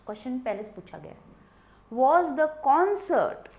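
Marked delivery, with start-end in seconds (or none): 2.0-3.5 "Was the concert" said loud and drawn out; teacher stressing the corrected phrase; emphatic slow reading. Shown as a demonstration. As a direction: hammer out "concert" without the s, slowly. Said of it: "concert का एस गायब हो जाएगा".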